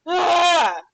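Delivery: sudden loud cry from stinging eyes; teary yelp. Real, not acted. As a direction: yelp as the onion fumes hit. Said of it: "It's the onions".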